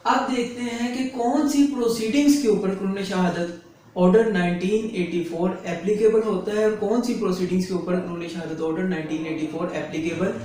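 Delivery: ordinary talk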